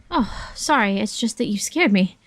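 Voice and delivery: usual speaking voice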